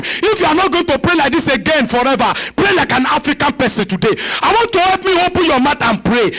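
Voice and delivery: ordinary talk, nothing marked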